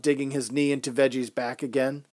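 plain reading voice

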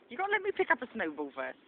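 normal talking voice

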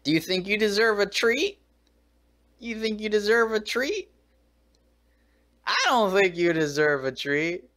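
high sing-song scolding of the dog; talking to the dog. Real, not acted. Scolding the dog in baby talk.

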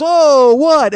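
loud, high mock-outraged yell; big retold "how dare you" shout; indignant shout. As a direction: blurt it out as an affronted heckler. Heckler reacts to being cursed.

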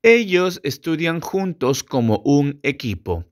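ordinary talk, nothing marked

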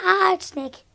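usual speaking voice

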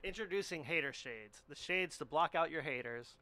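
infomercial voice. Pretend. Hater Shades mock infomercial.

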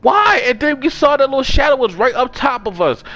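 high, distressed disbelief; aghast protest. Real, not acted. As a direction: protest as if personally betrayed. Upset that friend was eaten.

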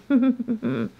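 high closed-mouth chuckle; quick humming giggle. Real, not acted. Laughing at the ghost in the bedroom, high chuckle.

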